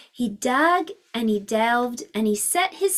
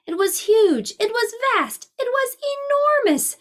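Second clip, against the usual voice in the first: each phrase climbs higher, bigger and bigger; building wonder; 11 semitones higher than usual. A narrator's reading. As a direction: swell with amazement up to enormous. Building size for children.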